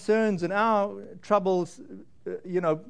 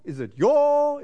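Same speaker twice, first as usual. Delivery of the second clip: "your" pitched high and leaned on; single out "your" with a lifted voice; stress on your; 6 semitones higher, 3 dB louder, slower than usual. Shown as a demonstration. Pastor testing each word of the verse, stressing "your". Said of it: "what words are highlighted in that sentence".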